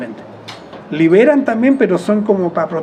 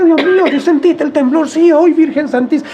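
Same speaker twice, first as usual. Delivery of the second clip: much higher, squealing mock panic of young shop girls; panicky shop girls; 9 semitones higher, 4 dB louder than usual. Shop girls at the cheese counter cry out during the earthquake, in a much higher squealing mock panic. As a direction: imitate the girls shrieking about the quake. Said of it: "decía".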